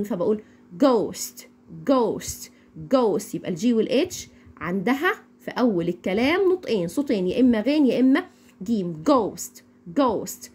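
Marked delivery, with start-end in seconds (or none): 0.8-3.3 "ghost" repeated with a hard g start. Shown as a demonstration. Teacher drills the class on "ghost" with hard g. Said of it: "بتدينا حرف". 9.1-10.5 "ghost" with a hard g, no h. Shown as a demonstration. Teacher demonstrates the rule, "ghost" with hard g. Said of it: "هنا نطقناها زي حرف الجيم بالظبط".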